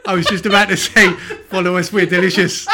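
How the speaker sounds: giggling through the words; laughing. Real, not acted. Co-host laughing while joking about catchphrase. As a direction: laugh it out mid-sentence.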